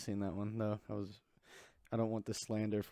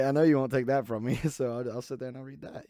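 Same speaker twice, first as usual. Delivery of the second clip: laughing; 2 semitones higher, 7 dB louder than usual. Retold or imitated.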